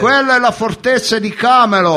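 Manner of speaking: grido acuto e forte, meravigliato; urlo rievocato. Retold or imitated. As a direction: annuncia la scoperta urlando. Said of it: "Allora a un certo punto urlai". (esaltazione comica a voce altissima)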